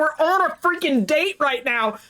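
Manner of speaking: high, thrilled announcement to the viewers; giddy excitement. Real, not acted. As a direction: squeal it, stretching out the big news. Excited she is on a date.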